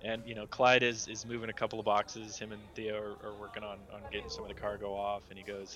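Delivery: ordinary talk, nothing marked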